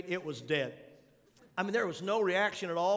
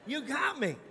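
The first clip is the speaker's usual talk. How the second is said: mock confession; 5 semitones higher, 5 dB louder than usual. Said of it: "And I said".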